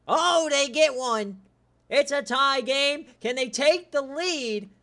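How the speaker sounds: loud, high, excited game call. Real, not acted. Host cheering Auburn's tying touchdown, real excited shout. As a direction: call the comeback out at full voice.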